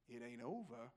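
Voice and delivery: very low, hushed tough-guy voice; Clint Eastwood. Acted. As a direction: drop it deep and quiet. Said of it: "In his best Clint Eastwood voice".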